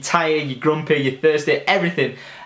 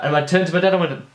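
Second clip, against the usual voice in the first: ordinary talk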